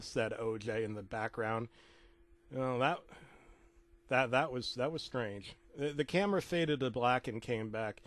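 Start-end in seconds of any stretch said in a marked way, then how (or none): none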